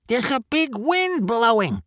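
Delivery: high animal character. Acted. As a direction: pipe up about the wind.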